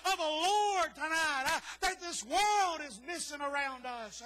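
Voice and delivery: loud, slightly cracking fervent preacher; preaching at full force. Acted. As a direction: cry it out to the congregation. Preacher climaxing on God's presence.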